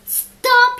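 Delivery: loud, high roar of Stop!. A narrator's reading. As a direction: bellow it out, begging to be spared. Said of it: "he roared".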